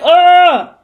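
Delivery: loud, drawn-out annoyed groan; long fed-up "ugh"; exasperated groan. Real, not acted. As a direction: groan out your irritation.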